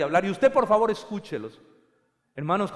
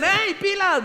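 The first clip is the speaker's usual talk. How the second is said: a hint of a wife's high "Ey, pilas!"; mock warning from the wife, raised high; 14 semitones higher, 8 dB louder, slower than usual. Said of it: "y que nos dicen".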